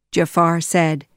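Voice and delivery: ordinary delivery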